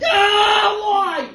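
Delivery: shrieked high "Ah, why?"; high mock birthing scream. Man imagining giving birth, high scream. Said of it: "It's like".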